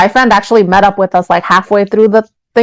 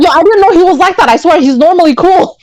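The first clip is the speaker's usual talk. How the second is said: friend's defensive excuse; 8 semitones higher than usual. Retold or imitated. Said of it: "And he's like".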